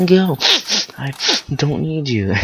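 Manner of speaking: high, loud, broken-up mock tantrum yell; halting, squealed imitation of yelling; yelling while wiping face. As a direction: act a crying kid yelling between wipes. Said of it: "trying to wipe my face as I yell".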